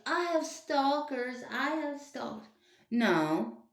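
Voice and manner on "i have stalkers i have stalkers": high, slow mocking quote; mocking Olivia. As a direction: parrot her in a high, whiny mock voice. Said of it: "she's like".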